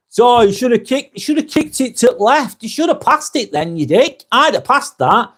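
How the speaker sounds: mock pub pundit. Mocking a drunk pub pundit.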